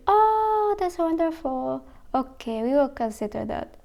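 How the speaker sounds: high, then soft and slow, fake-polite mock voice; a hammed-up polite official brushing you off. Mocking Japan's officials brushing off a vegan, in a fake-polite voice. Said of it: "They're gonna be".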